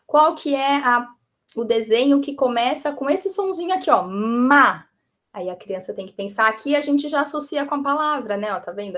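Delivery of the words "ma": "ma" loud and drawn out; isolated syllable. Shown as a demonstration. Teacher shows the starting sound, isolated emphatic syllable.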